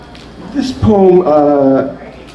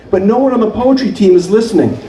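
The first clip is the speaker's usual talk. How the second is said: ordinary delivery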